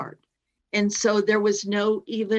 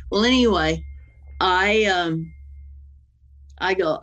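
usual speaking voice

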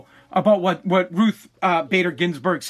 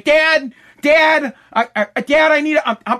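put-on higher, louder kid calling Dad over and over; imitating whiny daughter; 7 semitones higher, 5 dB louder than usual. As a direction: pester your dying dad like a needy kid. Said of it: "she's gonna be".

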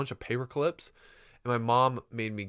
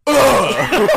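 loud, high exasperated "Ugh"; mock disgusted ugh; 12 semitones higher, 15 dB louder than usual. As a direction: belt out a cheeky groan at the teacher. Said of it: "he looks at her and goes".

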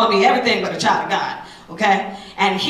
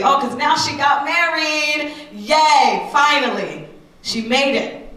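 mock cheer; 6 semitones higher, much slower than usual. Mocking society's cheers.

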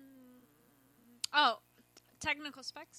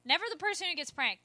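normal talking voice

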